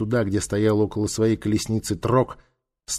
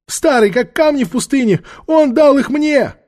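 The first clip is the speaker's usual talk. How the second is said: very high, loud whining cry; frightened old woman; 7 dB louder than usual. Acted. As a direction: plead shrilly under interrogation. Old woman pleads with interrogator.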